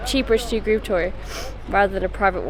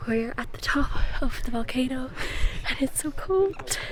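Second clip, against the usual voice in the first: whispering; 7 dB quieter than usual. Real, not acted. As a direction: whisper it to the camera. Filming at the summit.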